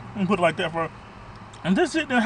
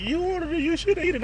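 higher mock nagging wife voice; imitating the wife; 8 semitones higher, 4 dB quieter than usual. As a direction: pitch it up and parrot her scolding. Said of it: "I had to hear my wife's mouth like".

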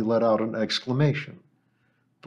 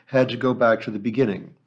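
ordinary delivery, nothing marked

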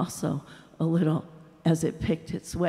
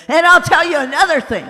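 loud, higher, fast retort; angry retort; 8 semitones higher, 9 dB louder, faster than usual. A narrator's reading. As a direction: fire back at him. Ghost keeps arguing.

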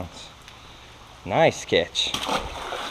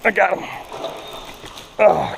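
normal talking voice

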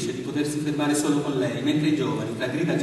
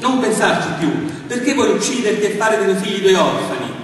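louder, higher, exasperated; irritated outburst; 6 semitones higher, 7 dB louder than usual. A narrator's reading. Philip urging Annie to stop grieving, exasperated and loud. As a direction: snap at her in frustration. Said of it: "quasi con un moto di stizza".

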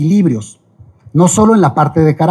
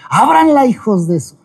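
mock panic shout, much higher on "Ábranla"; pretend panicked yell; 6 semitones higher than usual. Rector imagining himself fleeing a quake, mock panicked yell. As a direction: yell it like you're running downstairs in an earthquake. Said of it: "baje las escaleras yo corriendo y gritando".

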